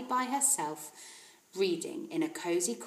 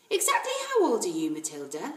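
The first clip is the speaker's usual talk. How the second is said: amazed librarian. Acted. Mrs Phelps stunned by Matilda, asking her age. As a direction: ask in disbelief. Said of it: "she asked".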